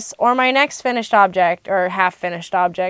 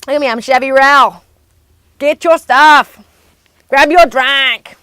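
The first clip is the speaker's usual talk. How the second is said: Chevy impression; 3 semitones higher, 6 dB louder than usual. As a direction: boss the room like Chevy would. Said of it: "It's my Chevy impression".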